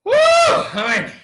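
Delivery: high shouted whoop on the woo; excited shout. Retold or imitated. Gasping at the workout load, an excited high shout. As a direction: let the woo fly up and out. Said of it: "And I'm like".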